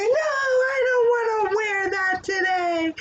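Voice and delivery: put-on high, whining kid's refusal; whiny child. Teacher voicing a grumpy kid refusing clothes, high whine.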